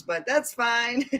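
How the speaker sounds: normal talking voice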